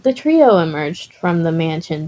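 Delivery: ordinary delivery, nothing marked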